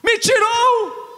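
preaching at full force. Acted. Interpreter relays the resurrection climax to the congregation with shouted fervor.

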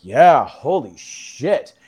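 slow, loud retold amazement; awed realization. Tripper grasping the mushroom's message, drawn-out awe. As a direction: drag it out as if it just hit you. Stunned reaction to mushroom's revelation.

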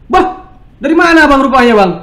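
loud, high mock North Sumatran bark; imitated barking shout. Host mimics North Sumatrans greeting a newcomer, imitated barking shout. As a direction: bellow it like a blunt local startling a visitor. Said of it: "ngomongnya ngebentak".

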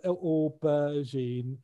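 aubergine drawn out slowly as OH-ber-zheen; slow, demonstrative "aubergine", each part separated; syllable by syllable. Shown as a demonstration. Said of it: "aubergine has four sylla".

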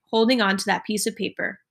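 ordinary delivery

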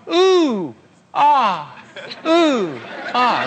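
high, sliding oohs and aahs of wonder. Acted. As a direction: coo in mock amazement at each new thing. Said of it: "And she's going".

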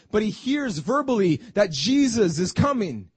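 ordinary talk, nothing marked

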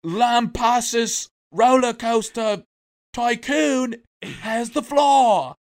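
loud, high, drawn-out British announcement; mock-grand British Speaker; parliamentary Speaker. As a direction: call the chamber to order, stretching every word. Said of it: "they're having a parliamentary hearing on it".

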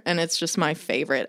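normal talking voice

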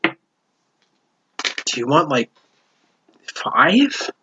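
slow, quiet, baffled question; baffled barkeep. Acted. Barkeep stunned by a two gold tip, drawn out. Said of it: "she kinda looks at it and goes".

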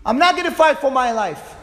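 loud, high, insisting he won't fight; shouted stubborn refusal; defiant shout. Retold or imitated. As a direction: throw the refusal out at full volume. Same words shouted as a defiant quote.

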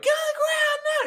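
very high, hammed-up raid yell; police shout. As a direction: shout it like cops bursting in. Acted police raid through busted door.

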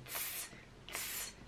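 "tss" like a Coca-Cola being opened; voiceless T bursting into a long hiss on "tss"; fizzy TS. Shown as a demonstration. Teacher imitating an opening soda, sharp hiss. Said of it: "uma Coca-Cola se abrindo".

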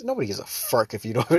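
usual speaking voice

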